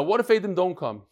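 ordinary talk, nothing marked

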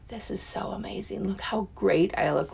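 awed whisper to the mirror. Retold or imitated. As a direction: breathe it out in wonder. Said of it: "just being like".

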